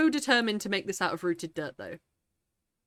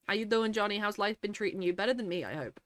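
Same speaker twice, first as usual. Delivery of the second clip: ordinary talk, nothing marked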